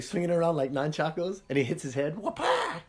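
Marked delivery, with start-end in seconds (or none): 2.1-2.8 whack sound effect. Retold or imitated. Imitates hitting his head.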